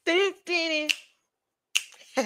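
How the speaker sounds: high, soft mock fanfare; mock drama sting; dramatic dun dun dun. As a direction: hum it like a suspense sting. Dramatic sound effect after snack.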